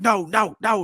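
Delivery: getting angry. Retold or imitated. Possessed woman protesting the cutting, angry mimicry. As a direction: imitate her mounting anger on each no. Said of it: "she's getting angry".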